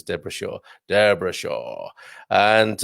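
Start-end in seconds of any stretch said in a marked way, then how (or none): 0.9-1.9 slow, low mock Irish accent; terrible Irish accent. Shown as a demonstration. Host playfully repeats listener's name, terrible Irish accent. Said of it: "that was a terrible Irish accent".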